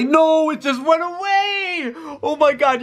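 high, drawn-out cry of frustration; dismayed wail. Real, not acted. Gamer watching the trade request vanish, wailing in dismay. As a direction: wail as the chance slips away.

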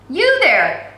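loud call across the street. A narrator's reading. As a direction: shout to stop a girl in the street. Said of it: "a voice called out".

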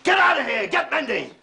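loud, high-pitched command; enraged boss driving a man off on an errand; furious order. Acted. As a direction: yell him out of the room.

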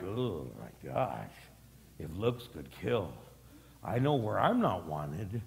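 low, slow, deflated muttering; wounded reaction. Acted. Churchgoer given a killing look, mock dejected. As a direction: sink down low as if withered by a look. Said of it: "just by the way they looked at you".

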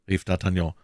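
ordinary delivery, nothing marked

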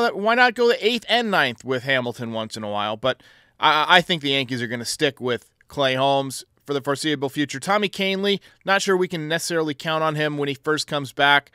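ordinary talk, nothing marked

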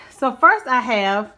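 ordinary talk, nothing marked

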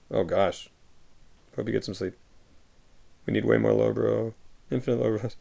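ordinary talk

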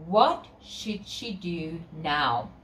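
ordinary delivery

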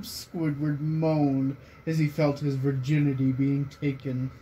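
ordinary delivery

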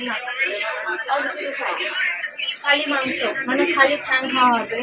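talking while gnawing bone; biting food between words; mouth full, chewing. Real, not acted. Seen 0: Holds chicken foot throughout; bites and gnaws it from 2.9s.

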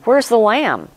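a hint of a child puzzling over the missing lamb; curious boy asking. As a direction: ask it like an observant young son. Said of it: "He said".

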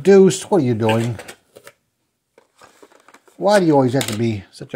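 loud call, then a fond scold; scolding the dog. Real, not acted. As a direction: catch the dog in the act.

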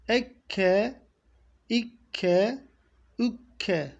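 Ekke with a clean doubled k; Ekke, clean kef; pure kef. Shown as a demonstration. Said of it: "Kef harfini normal K şeklinde çıkarmayacağız".

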